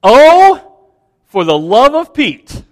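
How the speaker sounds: loud, high "Oh" then sighing fall; exasperated exclamation. Retold or imitated. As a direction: throw up your hands, exasperated. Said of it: "is the phrase".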